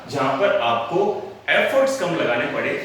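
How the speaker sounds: normal talking voice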